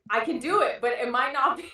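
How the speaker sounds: ordinary delivery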